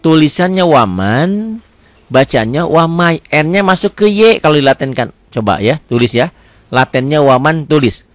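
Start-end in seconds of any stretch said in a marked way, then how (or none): none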